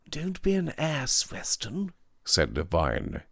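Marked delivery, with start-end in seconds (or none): 0.1-1.9 quieter, firmer rebuke; more serious. A narrator's reading. Devine rebukes Weston more seriously. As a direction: check him earnestly. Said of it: "in a more serious voice".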